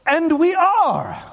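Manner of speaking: "And we are" high and stretched slowly; emphatic affirmation. Shown as a demonstration. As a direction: proclaim "And we are" with weight on every word. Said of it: "at the end, he said".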